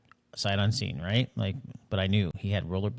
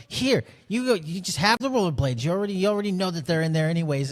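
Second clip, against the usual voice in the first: exasperated mom; 8 semitones higher than usual. Retold or imitated. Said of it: "she was just like".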